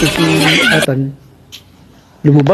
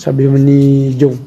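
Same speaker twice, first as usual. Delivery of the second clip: ordinary talk, nothing marked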